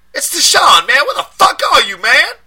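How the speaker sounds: high, loud street voice in a panic; panicked friend. A narrator's reading. Deshaun panicking down the phone at his friend, loud and high. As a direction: yell it like you're scared and angry.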